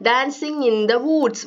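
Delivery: reading the poem very slowly, word by word. Acted. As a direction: read each line slowly for young pupils. Rereads line slowly.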